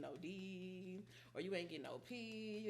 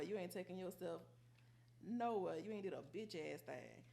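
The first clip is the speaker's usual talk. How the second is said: ordinary talk, nothing marked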